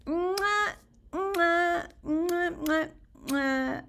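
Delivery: playful air kisses, one after another; blown kisses. Real, not acted. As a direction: blow kisses at the camera. Blowing kisses to viewers.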